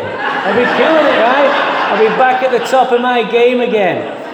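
hammed-up boast to himself; cocky excitement. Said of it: "I'm thinking".

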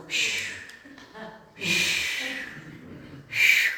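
mimicking the swish of the water tank; imitated water swish. Shown as a demonstration. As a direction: shush out the sound of water in the rower. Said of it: "so it sounds like when I'm swimming".

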